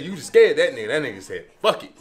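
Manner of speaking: normal talking voice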